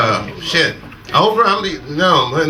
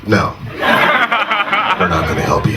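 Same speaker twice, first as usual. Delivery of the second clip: deadpan crowd; 8 semitones lower than usual. Retold or imitated. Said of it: "nine hundred people were like".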